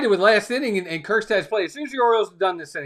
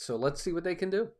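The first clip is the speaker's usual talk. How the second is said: whispering; 8 dB quieter than usual. Real, not acted. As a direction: drop to a hush. Late night stream, hushed aside.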